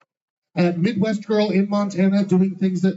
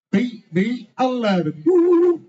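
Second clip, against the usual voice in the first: higher and louder, ending in a whoop; building to a joyful yell; excited shout; 6 semitones higher than usual. Acted. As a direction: whoop it up for the lucky number. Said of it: "do it out loud".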